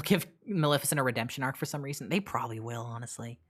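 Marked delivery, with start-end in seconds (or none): none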